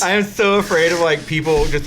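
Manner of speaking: usual speaking voice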